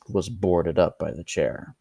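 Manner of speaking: usual speaking voice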